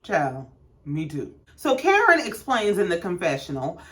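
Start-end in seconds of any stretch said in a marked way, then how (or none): 0.0-1.3 slow, low deadpan aside; deadpan confession of confusion; dry aside. Real, not acted. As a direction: say it wearily to the viewers.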